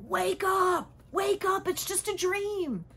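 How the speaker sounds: put-on high, loud urgent yell to wake a child; jolting shout. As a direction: shake them awake with a sharp shout. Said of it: "grab that child and go".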